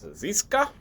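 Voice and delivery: ordinary delivery, nothing marked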